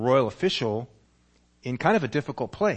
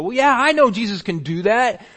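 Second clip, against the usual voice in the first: loud, high dismissive shrug; mock the been-there attitude; jaded churchgoer; 8 semitones higher, 9 dB louder than usual. Churchgoers who've heard it all, loud and blasé. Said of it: "most of us go".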